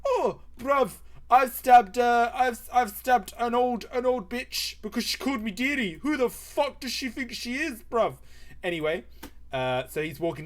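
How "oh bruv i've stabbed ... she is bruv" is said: high, loud, indignant put-on voice; boasting chav. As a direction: brag to a mate in an outraged high voice. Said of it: "And he's like".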